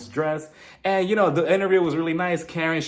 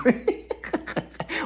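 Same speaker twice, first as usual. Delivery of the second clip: breathy high laugh; 10 semitones higher than usual. Real, not acted. Laugh between quotes.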